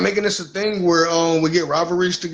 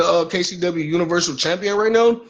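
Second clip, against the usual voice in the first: ordinary delivery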